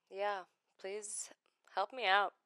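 normal talking voice

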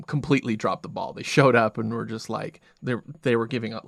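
usual speaking voice

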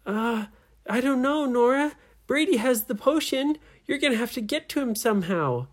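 high-pitched character voice; story friends answering. A narrator's reading. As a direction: advise the heroine in a high voice. Trapped friends answer Nora.

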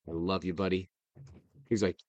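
normal talking voice

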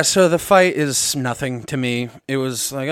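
usual speaking voice

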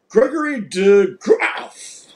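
slow, mock Dutch pronunciation of the name; Dutch accent. Teasing a viewer from the Netherlands, playful mock Dutch accent. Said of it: "'cause you're in the Netherlands".

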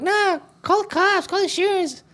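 very high, slow mimicking squeal; mock girlfriend. Girlfriend refusing to let it go, mocking high-pitched voice. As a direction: drag it out in a mocking falsetto. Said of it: "his girl's like".

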